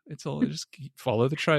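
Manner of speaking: calm denial meme. Retold or imitated. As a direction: gently insist everything is okay. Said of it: "the picture of the guy, guy with the harp and everything burning".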